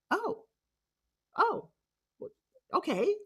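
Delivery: small high-pitched "oh" of discovery; a high, hushed reaction of dawning surprise; surprised. Retold or imitated. Speaker reliving seeing her new nose in the mirror, surprised.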